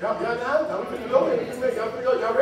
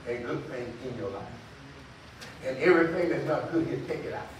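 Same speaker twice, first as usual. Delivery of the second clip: much softer, lower preaching voice; hushed teaching; 8 semitones lower, 12 dB quieter than usual. Real, not acted. Preacher explaining speaking into your life, hushed and earnest. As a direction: drop low and quiet to explain earnestly.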